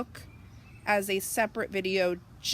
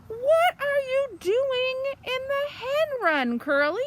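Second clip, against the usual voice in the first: high, loud telling-off; farmer shouting; 14 semitones higher, 6 dB louder, slower than usual. Acted. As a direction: call out sharply at the naughty pig. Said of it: "Missus Boot shouting at him".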